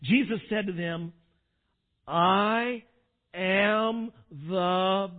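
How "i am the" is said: very slow, drawn out word by word; slow solemn declaration. Acted. Preacher quoting Jesus declaring who he is, slow and solemn. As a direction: stretch out each word with weight. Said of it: "I wonder if he said it like that".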